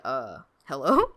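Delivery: low, deadpan baffled query; mock bewilderment. Viewer reacting to the group kicked out, mock bewildered. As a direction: act out a stunned, low-voiced double take. Stunned reaction to the bar kick.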